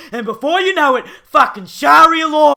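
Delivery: fear-mongering rant; alarmist build. Acted. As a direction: whip up panic.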